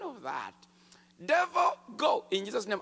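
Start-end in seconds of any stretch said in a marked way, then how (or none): 1.2-2.8 loud, high shout at the devil; shouted command. Retold or imitated. New believer rebukes the devil over the man, shouting. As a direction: bark the rebuke with full force. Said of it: "I said three times".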